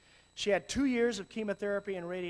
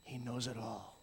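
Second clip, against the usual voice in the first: ordinary talk, nothing marked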